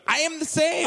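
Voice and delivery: sudden high-pitched agreement; high squeak. Acted.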